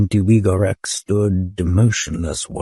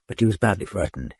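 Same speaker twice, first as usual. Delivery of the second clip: ordinary delivery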